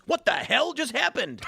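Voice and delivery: high, drawn-out shocked outburst; bewildered game character. Acted. Game character reacting to being shrunk, high bewildered shock. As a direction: cry it out in comic disbelief. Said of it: "and then he goes".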